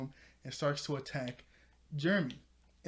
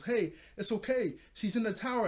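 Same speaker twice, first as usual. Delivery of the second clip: higher-pitched mock call to a teammate; retold relieved call; 7 semitones higher, 3 dB louder than usual. [name] tells the others Maya is safe, in a raised retold call. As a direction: act out a quick all-clear, pitched up.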